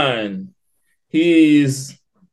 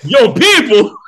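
incredulous; 8 semitones higher, 6 dB louder than usual. Acted. Mocking the quote.

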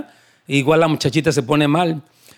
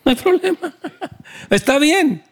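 much higher, laughing through the words; 9 semitones higher than usual. Real, not acted. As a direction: laugh at your own joke while saying "No hay problema". Laughs at own mock reply.

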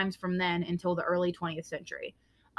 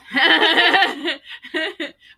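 loud laugh; 9 semitones higher, 10 dB louder than usual. Real, not acted.

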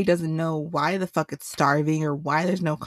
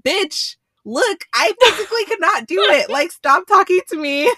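much higher, much louder shout laughing through it; retold yell with laughter; 12 semitones higher, 9 dB louder than usual. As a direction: scream it at her, cracking up. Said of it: "And I'm like".

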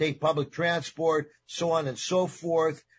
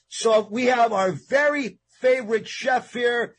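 excited announcer; 6 semitones higher, 3 dB louder than usual. Real, not acted. Host introducing chef loudly.

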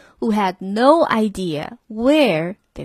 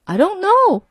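much higher, cheerful and carefree; 10 semitones higher, 4 dB louder than usual. A narrator's reading. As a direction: toss it off happily. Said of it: "replied the driver cheerfully".

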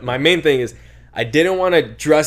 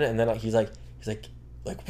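usual speaking voice

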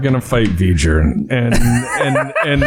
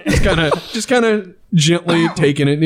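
ordinary talk, nothing marked

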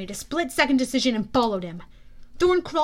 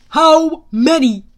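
very loud, slow, higher demand; booming demand; 4 semitones higher, 15 dB louder, slower than usual. A narrator's reading. General demanding an answer Thorne withheld, booming. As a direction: boom it out, word by word. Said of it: "the general demanded".

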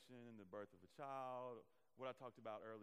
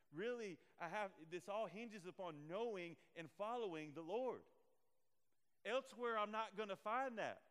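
higher, louder impassioned preaching; preaching at full force; 5 semitones higher, 5 dB louder than usual. Acted. Preacher urging the church toward the Lord, impassioned. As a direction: press the point home with fire.

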